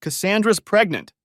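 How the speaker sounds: loud, high, furious outburst; shaking with fury. A narrator's reading. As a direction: spit it out in a rage. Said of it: "his voice shaking with fury".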